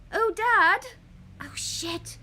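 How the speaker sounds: high, loud panicked gasp; mock panic. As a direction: act the horror of the mistake. Panics after shaving mistake.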